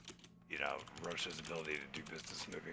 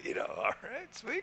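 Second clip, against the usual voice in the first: high squeaky relief. Retold or imitated. Retelling his reply to the judge, a high squeaky relief. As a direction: voice jumps way up in delighted relief. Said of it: "I was like".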